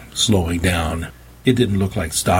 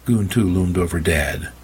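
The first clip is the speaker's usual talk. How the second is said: ordinary delivery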